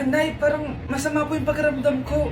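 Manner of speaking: usual speaking voice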